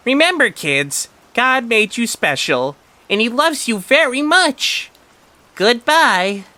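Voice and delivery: high, slow, loud cartoon voice saying goodbye; a cartoon cucumber's sad farewell to kids. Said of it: "in a sad voice".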